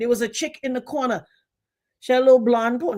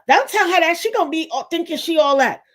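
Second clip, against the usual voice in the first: high mocking hater voice; 6 semitones higher, 4 dB louder than usual. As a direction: mimic a jealous hater. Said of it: "and they like".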